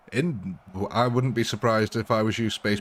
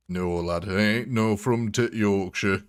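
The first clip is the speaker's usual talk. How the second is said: a light broad Yorkshire lilt; gruff Yorkshire lad voice, lightly done; Yorkshire accent. Shown as a demonstration. Streamer denying being from Yorkshire in mock Yorkshire accent. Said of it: "always talk a bit more like this".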